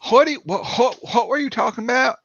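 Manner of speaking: high-pitched, rich Southeast-Texan twang; thick Texan accent. Retold or imitated. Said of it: "Southeast slash Texan a-, accent".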